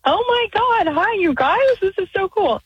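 high-pitched, gushing excitement; thrilled greeting. Real, not acted. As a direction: greet your heroes, voice soaring. Thrilled caller greets the hosts.

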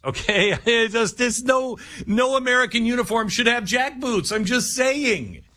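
giggling through the complaint; laughing. Real, not acted. Laughing at the jack boots.

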